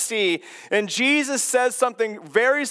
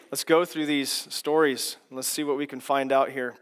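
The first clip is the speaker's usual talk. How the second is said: ordinary delivery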